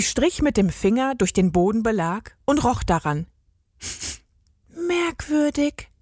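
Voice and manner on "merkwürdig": put-on higher, slow, puzzled girl's murmur; whispered wonder. A narrator's reading. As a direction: draw it out, puzzled. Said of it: "flüsterte sie". (verwundert geflüstert)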